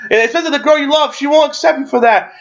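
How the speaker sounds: quick, high-pitched showy warning; hammed-up moral reproach; mock admonishing. Acting Deadpool's showy disapproval.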